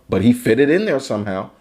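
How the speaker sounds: ordinary talk, nothing marked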